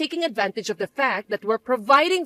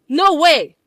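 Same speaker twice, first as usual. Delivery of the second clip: loud, higher indignant refusal; indignant shout; 5 semitones higher, 7 dB louder than usual. Acted. Mother shouting down her son's plea, indignant.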